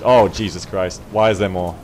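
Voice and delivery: ordinary talk, nothing marked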